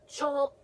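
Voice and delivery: sudden gasp then a snapping chomp. Acted. Teacher acting out the goldfish bite, sudden snap. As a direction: bite down hard on the word like a fish.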